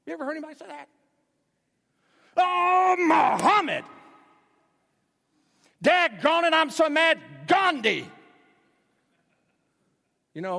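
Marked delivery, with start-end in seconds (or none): 2.4-3.8 much higher, louder, slow mock outburst; mock angry exclamation. Preacher mocks someone swearing by Buddha when mad, hammed-up. 5.8-8.1 much higher, louder, slow mock outburst; mock angry exclamation. Preacher mocks someone swearing by Buddha when mad, hammed-up.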